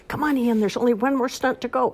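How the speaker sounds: put-on high, upbeat little girl pep; encouraging. Olivia urging tired Ian to keep going, encouraging. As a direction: cheer him along. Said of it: "Olivia said encouragingly".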